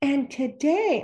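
soft, slow, singsong meeting voice; PTA voice. Retold or imitated. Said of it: "I called it the P T A voice".

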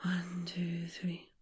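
low whispered counting. Shown as a demonstration. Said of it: "breathe in for three and out for three".

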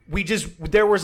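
ordinary talk

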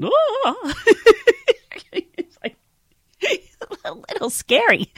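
high, squealing laugh through the words; awkward shocked laughter. Real, not acted. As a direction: laugh it off, half alarmed. Shocked laugh after compliment.

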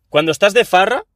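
"Cuando estás de farra" lifted high as a clip opener; presenter restart. Shown as a demonstration. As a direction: start over with a punchier host voice. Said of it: "voy a empezar de cero porque quiero hacer bien el clip".

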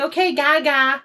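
high, mock-agreeable kid voice; teasing kids. Kids answering the grandmother who hated it, teasing.

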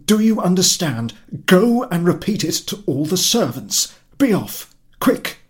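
high, loud rushed commands; husband ordering servant to say he is out; urgent orders. Acted. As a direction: bark out the lie to tell.

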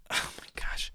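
whispered shock; whispering in shock at the photo; breathy aside. Real, not acted. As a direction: breathe it out in disbelief. Gasp at photo.

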